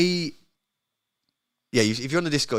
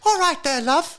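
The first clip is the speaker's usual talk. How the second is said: put-on very high, squeaky British accent; mock accent impression; 3 dB louder than usual. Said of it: "that was an impression of me".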